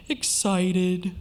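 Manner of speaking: dejected, drooping delivery; sad tone. Shown as a demonstration. Lecturer demonstrates a happy word in a sad voice, sad. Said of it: "a happy word in a sad tone of voice".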